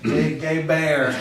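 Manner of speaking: ordinary talk, nothing marked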